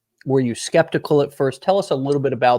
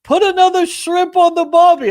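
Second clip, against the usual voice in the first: loud, high-pitched hammed-up Aussie; mock Australian accent; 7 dB louder than usual. Host showing his muddled UK impression, mock Australian accent. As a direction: belt out a cartoonish Australian accent. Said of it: "I'm always like".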